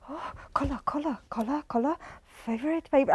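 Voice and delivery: 1 confused mumble. Retold or imitated. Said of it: "he's going".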